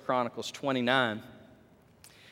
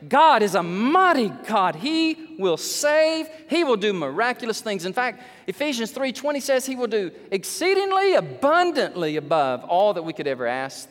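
full-force preaching; 7 semitones higher, 6 dB louder than usual. Real, not acted. Preacher urges congregation to trust a mighty God, proclaiming. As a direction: build fervently on each promise.

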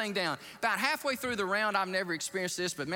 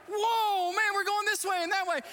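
high, loud, startled exclamation; alarmed whoa; 4 dB louder than usual. Retold or imitated. Pastor taking the stick from the pilot, startled and loud. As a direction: hang on as the jet lurches. Grabbing the stick, jet swerving.

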